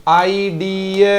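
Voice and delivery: आइडिया drawn out slowly, each part clear; slow model word. Shown as a demonstration. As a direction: stretch आइडिया so children hear the ia. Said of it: "Karamjit ने लिखा आइडिया".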